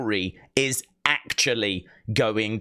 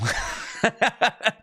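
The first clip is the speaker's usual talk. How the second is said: short laugh; 9 semitones higher than usual. Real, not acted. Laughs at the quote.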